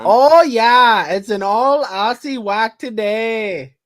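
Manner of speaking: put-on loud, high, drawn-out Aussie accent; big slow mock-Aussie cheer; put-on Australian accent. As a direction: holler it in a hammed-up Australian accent. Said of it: "break out my Australian accent".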